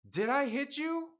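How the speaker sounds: slow, high, incredulous question; exaggerated retort in a retold quarrel; acted pointed comeback. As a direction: spell it out as if to someone dense. Replays his pointed comeback.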